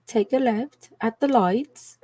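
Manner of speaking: slow, slightly lilting navigation voice, lightly put on; a hint of Siri giving directions; lightly imitated UK Siri. Streamer quoting her UK Siri's directions, lightly imitated. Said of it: "She would say".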